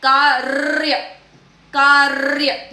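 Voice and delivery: कार्य as "kaarrr-ya" with a long trill; correct rolled r. Shown as a demonstration. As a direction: roll the r in कार्य, no vowel before "ya". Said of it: "मगर हमें क्या है".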